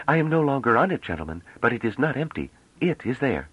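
put-on high-pitched, firm young woman; assertive young envoy. Young envoy corrects the gentlemen, assertive. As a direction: insist in a high, assured voice.

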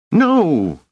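put-on high, loud boyish shriek; piercing, drawn-out shout of refusal. A narrator's reading. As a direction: cry out in desperate protest. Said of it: "shrieked Rache".

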